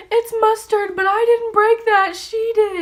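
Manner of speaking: fake-cry the excuse to the cop. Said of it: "So I fake cried".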